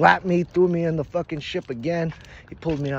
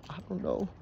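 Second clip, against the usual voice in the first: soft, high, offhand murmur; coy shrug; 5 semitones higher, 9 dB quieter than usual. Retold or imitated. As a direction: say it small, as if clueless. Modest answer to amazed tribe.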